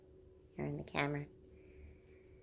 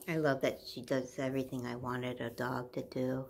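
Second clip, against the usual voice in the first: ordinary talk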